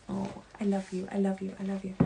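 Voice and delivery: tender. Real, not acted. Tender aside to listener.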